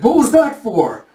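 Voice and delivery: high, indignant mock protest; hurt protest. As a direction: object as if just smacked. Smacked character protests.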